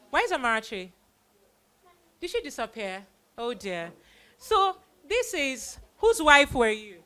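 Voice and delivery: ordinary talk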